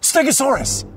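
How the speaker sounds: high, loud eager shout; excited guess. Acted.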